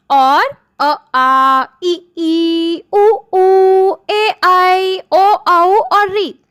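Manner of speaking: each vowel drawn out clearly; reciting vowels. Shown as a demonstration. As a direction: recite the vowels slowly one by one. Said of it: "ये जो ग्यारह वर्ण हैं". (स्वर धीरे-धीरे साफ़ बोलना)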